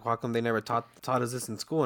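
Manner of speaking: usual speaking voice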